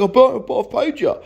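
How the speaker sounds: high, loud mock whine; imitated frantic objection, pitched right up; protesting. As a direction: stammer an indignant protest like the buyer. Buyer protests again.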